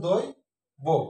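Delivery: দই rising, pause, then বো; correct pronunciation. Shown as a demonstration. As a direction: split it 'doi... bo', round o in both. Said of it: "সঠিক উচ্চারণ". (দই... বো, ভেঙে উচ্চারণ)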